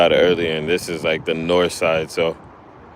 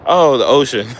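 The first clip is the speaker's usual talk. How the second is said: surprised; 7 semitones higher, 6 dB louder than usual. Real, not acted. Realising the ocean, not mountains.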